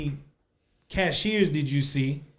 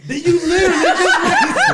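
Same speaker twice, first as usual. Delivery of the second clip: laughing; 13 semitones higher, 6 dB louder than usual. Real, not acted. Laughing accusation.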